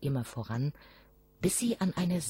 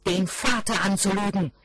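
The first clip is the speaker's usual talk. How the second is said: louder, scolding outburst; angry father; 5 semitones higher, 7 dB louder than usual. A narrator's reading. Father scolding his son over the stones, loud and angry. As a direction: scold the lying son hard. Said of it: "Wütend schleuderte er".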